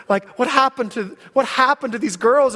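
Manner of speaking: ordinary delivery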